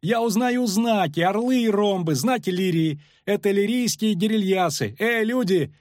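high, eager calling out; excited shouting. A narrator's reading. Poet recognises the banners and hails riders, shouting. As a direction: call out high to those on shore. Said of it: "закричал Лютик".

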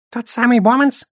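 high-pitched squeak, rising into a question; squeaky goblin. A narrator's reading. Goblin asking about the famous Bomanz, squeaking. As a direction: squeak it out like a startled little goblin. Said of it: "пискнул гоблин".

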